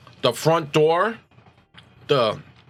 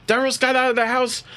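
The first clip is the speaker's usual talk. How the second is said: put-on high, urgent pleading girl's voice; a teen girl, much higher, pressing her father to hurry; upset teenage girl; 11 semitones higher than usual. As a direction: beg her dad to come home. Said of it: "she's like".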